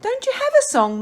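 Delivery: very high, small worm voice; little creature's question; tiny voice. A narrator's reading. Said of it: "the tiny voice asked".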